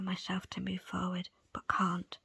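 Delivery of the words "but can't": plain reading voice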